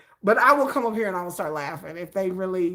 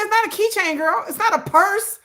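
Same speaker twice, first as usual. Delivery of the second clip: exasperated scolding; 10 semitones higher, 4 dB louder than usual. Retold or imitated. Scolding friend about Labubu.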